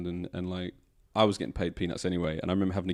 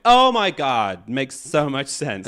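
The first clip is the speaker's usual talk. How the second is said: high, loud, drawn-out amazement; mock revelation; 6 semitones higher, 8 dB louder, much slower than usual. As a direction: imitate an awestruck American, slow and gushing. Imitating Americans having a revelation.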